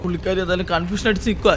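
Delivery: usual speaking voice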